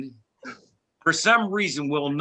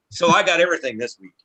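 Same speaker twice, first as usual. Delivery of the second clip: usual speaking voice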